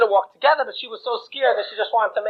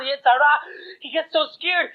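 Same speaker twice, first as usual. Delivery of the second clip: usual speaking voice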